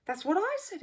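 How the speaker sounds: loud, high eager agreement; exclaiming. Acted. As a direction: jump in to claim it first. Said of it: "exclaimed the first man".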